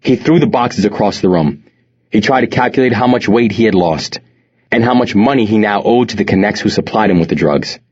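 ordinary delivery, nothing marked

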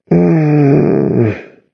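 high, drowsy hum; sleepy murmur. A narrator's reading. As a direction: murmur as if barely awake. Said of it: "Cassidy murmured sleepily".

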